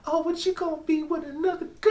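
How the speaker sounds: put-on very high squeaky girl voice, laughing; man doing a woman's voice. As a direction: shriek it like a jealous girlfriend. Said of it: "That's how they voice sound?".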